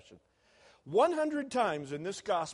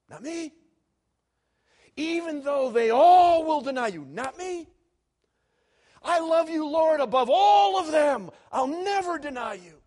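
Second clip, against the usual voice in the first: loud, high, proud declaration of loyalty; boastful shout; 12 semitones higher, 15 dB louder than usual. Acted. Peter boasting to Jesus that he will never deny him, loud and high. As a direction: shout your loyalty over everyone.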